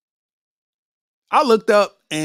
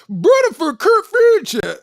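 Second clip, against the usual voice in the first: much higher, slow, louder mock Memphis drawl; hammed-up Memphis accent; 10 semitones higher, 5 dB louder, slower than usual. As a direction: stretch it out mocking the Memphis accent. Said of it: "with that Memphis accent".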